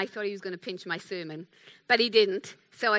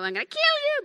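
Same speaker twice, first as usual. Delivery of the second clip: high-pitched, louder play threat; mock sibling threat; 5 dB louder than usual. Big brothers threatening siblings, high mock menace. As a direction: squeal it like a squabbling brother.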